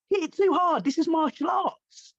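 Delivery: can't believe the complaint; incredulous. Retold or imitated. His own incredulous thought.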